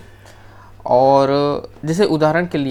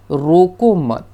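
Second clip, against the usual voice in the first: रोको मत as one louder unit, then a stop; pause after मत; 6 dB louder, much slower than usual. Shown as a demonstration. Teacher showing the second reading, pause after मत. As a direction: join रोको to मत and halt. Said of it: "यहाँ पे लगा हुआ है".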